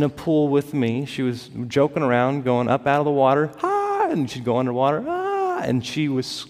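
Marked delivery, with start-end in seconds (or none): 3.6-4.1 short high gasping cry, retold; sister's gasp. Preacher mimics his drowning sister's high gasp. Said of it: "kind of making this sound". 5.1-5.6 short high gasping cry, retold; sister's gasp. Preacher mimics his drowning sister's high gasp. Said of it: "kind of making this sound".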